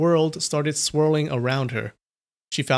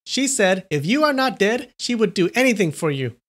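loud voice; 7 semitones higher than usual. A narrator's reading. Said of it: "continued in a loud voice".

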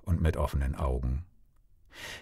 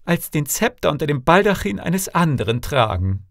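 high-pitched pleading princess; devoted daughter, pleading; 9 semitones higher than usual. A narrator's reading. Princess vows to her father never to leave him, high earnest pleading.

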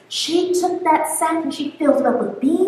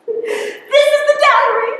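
high, slow reveal breaking into laughter; the king shows his tiny dowry, barely holding a laugh; 10 semitones higher than usual. Acted. King proudly reveals tiny dowry, laughing.

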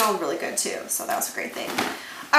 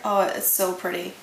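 normal talking voice

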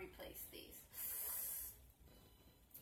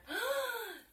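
surprised gasp; 12 semitones higher than usual. Real, not acted. Finds clip mark on partner.

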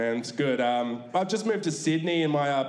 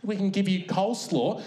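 server's offer; 5 semitones higher than usual. Retold or imitated. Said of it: "KFC lady offers coleslaw".

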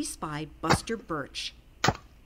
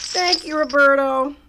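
loud, higher little rabbit voice; polite but overwhelmed; bunny thanks with a sigh; 6 semitones higher, 14 dB louder than usual. A narrator's reading.

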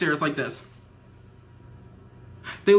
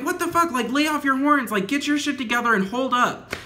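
angry yell; 7 semitones higher than usual. Retold or imitated. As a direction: tell the drivers off, fed up. Yelling at honking drivers.

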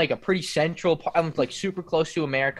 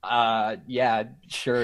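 low, unenthusiastic imitation; lukewarm agreement; 6 semitones lower, 3 dB quieter, much slower than usual. Guest retells his French friends' verdict, lukewarm and low. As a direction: mimic a half-hearted okay in a lower voice.

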